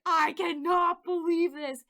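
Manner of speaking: high, loud, slow cry of disbelief; mortified outburst. Retold or imitated. Wife in the car with husband, high and loud in disbelief. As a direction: wail it in mock despair.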